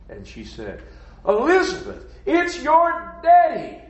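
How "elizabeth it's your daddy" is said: high, loud, drawn-out cry of recognition; astonished high shout; joyful cry. Acted. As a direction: call out in amazed joy, stretching the words high. Mother in story cries out in joy.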